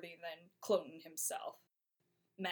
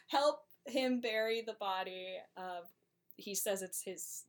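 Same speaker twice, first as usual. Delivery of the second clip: ordinary delivery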